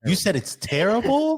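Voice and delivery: high, rising outraged question; incredulous. Real, not acted. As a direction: challenge the verdict in real disbelief. Challenges friend's verdict on song.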